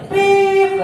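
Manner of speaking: high, loud pip of a parking whistle; sudden high whistle sound; whistle imitation. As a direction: chirp it like a parking attendant's whistle.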